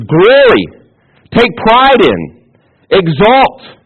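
loud, high, punched-out command; emphatic exclamation. Shown as a demonstration. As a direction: make each word jump out. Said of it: "this is how they hear it, it would jump out".

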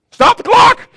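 very high, very loud scared yell; panicked shout. Retold or imitated. Scared clock keeper repeating the order, shrieking in panic. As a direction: cry it out in alarm.

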